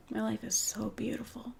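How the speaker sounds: tender unvoiced whisper; soft emotional whisper. Real, not acted. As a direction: breathe it out softly, moved. Emotional whisper about her life.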